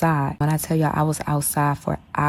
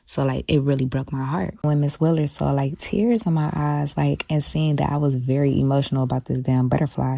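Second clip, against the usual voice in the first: ordinary talk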